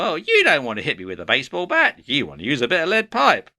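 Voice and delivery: high, quick nitpicking character voice; negative nitpicker. Acted. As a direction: correct them fast in an irritating comic voice. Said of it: "they'd probably go".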